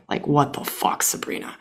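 breathy whisper to herself; whispered aside of disbelief at herself; whispered self-scolding. Real, not acted. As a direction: hiss it at yourself under your breath.

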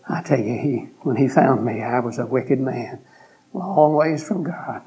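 ordinary delivery

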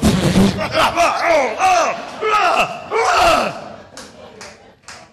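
loud high wordless yelling; mosquito scream. Acted. Mosquito recoiling at the comeback, frantic screaming. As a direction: screech in repeated bursts as the mosquito. Acted mosquito reaction, shouted noise.

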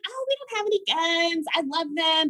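high chirpy reassurance; breezy and clueless; bright innocent chatter; naive cheerful self. Retold or imitated.

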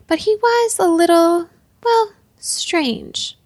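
high, drawn-out hedging; hesitant, awkward euphemism; tactful hesitation. A narrator's reading. Minister searching for a tactful word.

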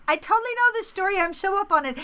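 high, fast know-it-all gush; smug listener. Retold or imitated. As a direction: play the smug insider. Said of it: "will be like".

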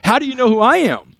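high, breathless fan gushing, pitch climbing; a starstruck fan who can't believe the call; freaking out. Retold or imitated. Fan on the phone to his hero, freaking out with excitement. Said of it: "like, freaking out".

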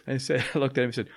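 ordinary delivery

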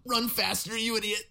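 high mock drill-sergeant bark; yelling coach. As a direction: bark at a lagging runner. Mock harsh coach lyric.

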